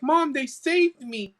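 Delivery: put-on high, drawn-out little-boy call; excited child. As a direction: call to Mom, thrilled to be rescued. Boy runs up to his mom.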